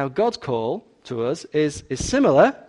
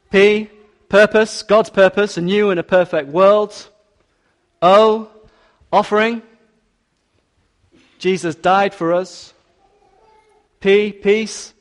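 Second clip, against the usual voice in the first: preaching at full force; 4 semitones higher, 5 dB louder than usual. Acted. Spelling out the acrostic.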